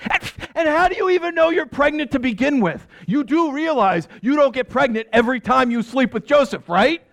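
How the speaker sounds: very high, incredulous scolding; can't believe what he's hearing; exasperated disbelief. Acted. Father disbelieves daughter.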